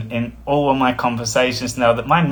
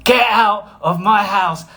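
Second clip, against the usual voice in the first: slow, higher, louder yell; retold outburst; 6 semitones higher, 3 dB louder, slower than usual. Evicting the angry housemate, slow loud yell. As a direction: yell it out slowly. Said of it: "the next words that come out".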